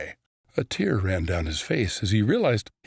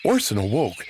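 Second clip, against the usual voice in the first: ordinary delivery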